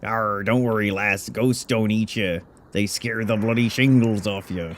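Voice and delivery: lightly put-on pirate accent; an old sea dog striking a deal; pirate captain voice. Old captain bargaining with the hero, mild pirate voice.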